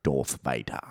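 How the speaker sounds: put-on low, prim English accent; Darth Vader. Trying friend's suggested English accent, deep and proper. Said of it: "like, a prim and proper, like, English accent".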